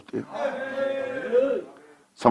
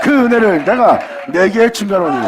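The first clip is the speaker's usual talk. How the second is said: higher and louder, ringing out; climactic proclamation; 8 semitones higher, 7 dB louder than usual. Acted. Preacher voicing God to the congregation, soaring proclamation. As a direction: proclaim it as God's own word.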